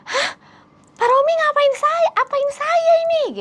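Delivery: high, loud shocked scream. Retold or imitated. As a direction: shriek it at him. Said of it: "langsung teriak". (berteriak histeris, suara tinggi)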